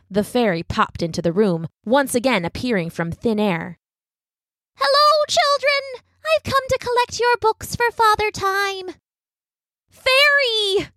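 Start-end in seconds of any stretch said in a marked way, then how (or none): 4.8-8.9 high, sweet tiny fairy voice. A narrator's reading. Fairy returns and speaks. 9.9-10.9 high delighted squeal; excited squeal. A narrator's reading. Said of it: "squealed Navia".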